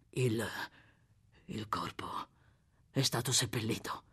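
hushed, faltering, nearly whispered question; fearful whisper. Acted. Sykes asks about the buried body in a fearful hush. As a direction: breathe the words out in dread, halting.

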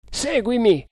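high, urgent call; urgent command. A narrator's reading. Fugitive urging his companion to follow, urgent command.

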